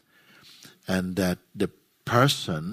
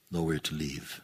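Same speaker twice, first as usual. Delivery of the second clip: low, soft phrases, each left to settle; guided meditation; 2 semitones lower, 6 dB quieter than usual. Real, not acted. Teacher guides listeners into resting in their own presence, guided meditation. Leads a silent guided contemplation.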